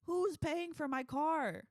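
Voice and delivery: loud, high, slow outraged demand; indignant shout. Acted. A driver yelling about his wrecked car. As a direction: yell it like the victim of a wrecked car. Clip-like outburst, loud and far.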